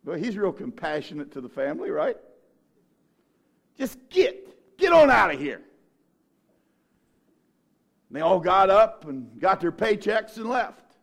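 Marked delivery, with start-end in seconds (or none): 3.8-5.6 loud, high shove-off shout; shooing them out. Retold or imitated. Preacher voicing Jesus sending mourners away, loud. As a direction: chase them out with your voice.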